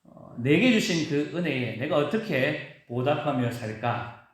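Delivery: normal talking voice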